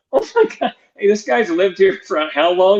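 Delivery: high-pitched mock exclamation, then settling; retold disbelief. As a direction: squeal "Oh my God" in amused disbelief. Retelling getting lost with the coach.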